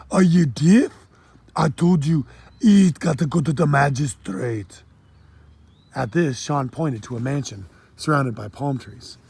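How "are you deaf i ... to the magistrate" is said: louder, higher Jamaican scolding; impatient patois; Jamaican accent. Acted. Pirate scolds the boy for not listening, Jamaican accent. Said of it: "heavy accent".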